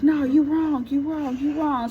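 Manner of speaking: high, repetitive mock protest; imitated scolding neighbors. Neighbors surrounding the boyfriend after the hit, high mock scolding. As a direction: chant it like indignant onlookers.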